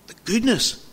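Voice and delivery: "goodness" lifted high, then falling; stressing goodness. Real, not acted. Preacher to congregation, awed stress on goodness. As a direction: linger on "goodness" with awe.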